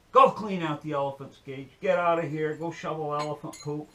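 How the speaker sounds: loud, scolding orders; forceful mock telling-off; angry boss, acted. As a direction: play a boss sending the kid packing. Joke's boss orders the kid away.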